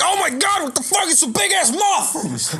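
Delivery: high-pitched mock-panicked squeal; hammed-up alarm, stretched at the end; startled warrior. Warrior spots a giant moth, high mock panic. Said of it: "And then the warrior is like".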